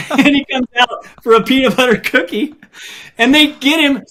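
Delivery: chuckling through the words; laughing. Real, not acted. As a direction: laugh as you tell it. Laugh heard while telling.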